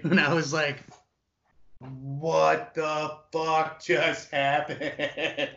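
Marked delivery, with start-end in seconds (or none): 1.8-5.5 laughing in disbelief. Retold or imitated. Stunned after being accepted.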